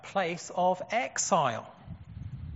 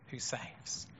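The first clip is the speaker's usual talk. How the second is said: hushed; 6 semitones lower, 12 dB quieter than usual. Real, not acted. Repeated climax, God saves.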